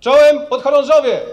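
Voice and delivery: loud, high military greeting; parade greeting shout. Real, not acted. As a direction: call out to the ranks. General greets the cadets. (głośne wojskowe powitanie)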